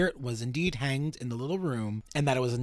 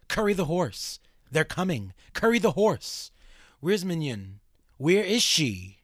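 raised, urgent and haunted, a notch higher; a lightly acted tormented ghost calling out; possessed man crying for the horse and Mignon, higher and urgent; frantic spirit voice; 5 semitones higher, 4 dB louder than usual. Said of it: "breaks into mad laughter, full of hatred".

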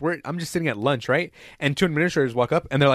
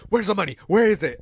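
higher-pitched mock demand; pissed-off administrator; 5 semitones higher than usual. Angry administrator rifling through backpack for money, mock pissed-off. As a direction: imitate an angry interrogator, pitched up. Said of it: "she was so fucking pissed".

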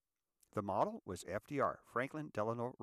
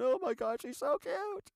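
much higher, gushing over a cute photo; gushing proud dad; 4 dB louder than usual. Retold or imitated. Proud father showing photos of his baby son, gushing high. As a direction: coo over the baby like a doting grandpa. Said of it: "And he sends me photos and goes".

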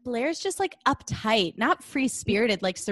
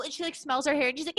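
ordinary talk, nothing marked

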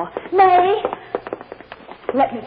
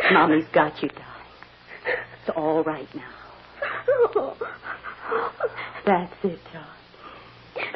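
softer, hushed and tender; soothing mother; 3 semitones lower, 4 dB quieter than usual. Acted. Mother hushing her crying daughter in her arms, soft. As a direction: lull her quietly, close to her ear.

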